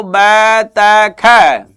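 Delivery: बैतख stretched very slowly; drawn-out sounding out. Shown as a demonstration. Teacher stretching बैतख out slowly for the class. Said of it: "ये जैसे बतख नहीं".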